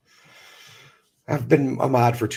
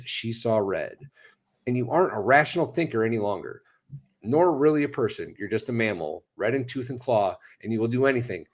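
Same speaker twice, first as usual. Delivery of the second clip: reading aloud; 4 semitones higher than usual. Acted. Reads a written essay aloud.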